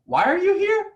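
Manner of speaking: high, sharp incredulous why are you here; blunt demand. Retold or imitated. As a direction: toss it out high and abrupt, mock accusing. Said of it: "my words come out and I'm like".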